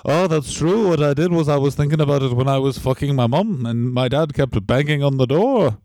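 put-on hammy mixed Irish accent; loose, over-the-top Irish accent. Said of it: "Now it's Irish again".

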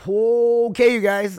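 high, loud burst; loud hyped call. Real, not acted. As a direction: blast it out with hype. Loud hyped podcast opener.

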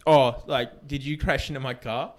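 re-enacting a mildly irked question; understated irritation, near everyday pitch; annoyed. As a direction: ask it with contained annoyance. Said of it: "You could obviously tell I was annoyed".

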